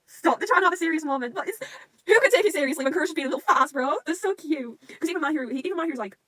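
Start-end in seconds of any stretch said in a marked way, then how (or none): 0.1-4.8 talking through laughter; laughing. Real, not acted. Fan to viewers, laughing at the silly scene. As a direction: laugh while describing it.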